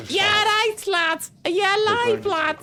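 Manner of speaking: put-on Scouse old woman. Streamer imitating the game's old woman in a high Scouse accent. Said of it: "the old woman was like".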